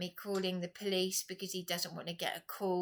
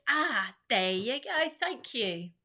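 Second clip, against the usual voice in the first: triumphant retort; 4 semitones higher, 5 dB louder, slower than usual. Retold or imitated. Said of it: "I said".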